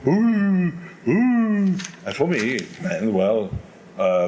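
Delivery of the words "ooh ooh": loud high crowd ooh; mock crowd booing ooh. Opera audience loudly hooting at the singer. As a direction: hoot like a disapproving audience. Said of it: "I could hear the public going".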